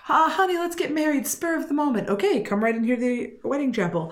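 high, bright naive voice; mock bride. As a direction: playfully voice the unsuspecting bride.

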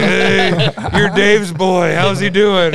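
loud high hammed-up drunk greeting; Carl greeting a kid. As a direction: call out to the kid, mock drunk. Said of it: "and he's like".

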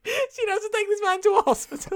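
high-pitched laugh through the words. Real, not acted. Friend cracking up at the film's plot, laughing. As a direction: laugh while saying it.

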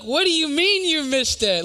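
high, loud, incredulous demand; acted-out exasperation, loud and high-pitched; exasperated outburst. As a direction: snap it at the driver, pitch shooting way up.

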